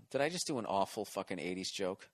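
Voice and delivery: ordinary talk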